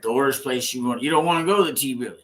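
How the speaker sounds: ordinary talk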